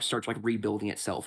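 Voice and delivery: ordinary delivery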